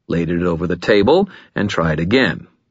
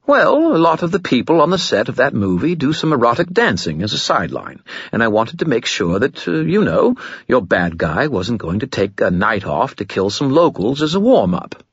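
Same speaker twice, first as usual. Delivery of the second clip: higher, fast wheedling spirit; fast-talking skull; 6 semitones higher than usual. Acted. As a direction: babble the excuse quickly. Skull rushing excuse.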